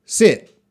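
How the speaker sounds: short lax i in "sit". Shown as a demonstration. Teacher modelling "sit" for the class. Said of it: "Listen carefully".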